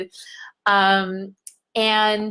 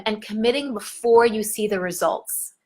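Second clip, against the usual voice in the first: ordinary talk, nothing marked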